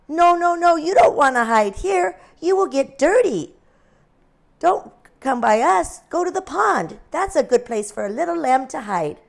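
high, loud pig; bossy pig shooing. A narrator's reading. Pig shooing the lamb away from the sty, loud. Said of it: "pig speaks".

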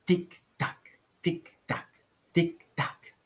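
ticking clock. A narrator's reading. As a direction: tick steadily like a clock.

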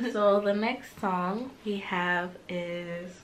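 ordinary talk

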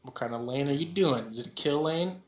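ordinary talk

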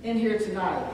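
loud, rising preacher cadence to the congregation; preaching at full force. Acted. A preacher telling the congregation God's mercies cover them, loud and fervent. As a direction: drive it home to the congregation.

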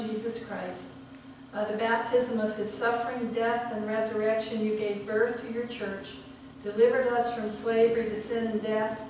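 solemn liturgy, swelling on key phrases; liturgical reading. Acted. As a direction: proclaim the prayer with reverence. Leads communion liturgy.

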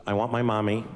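slow, deliberate, a hint of a stubborn little girl; cold child's rejection. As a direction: lay it out slowly and firmly. Daughter rejecting dad at night.

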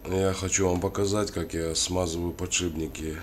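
normal talking voice

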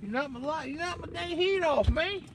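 usual speaking voice

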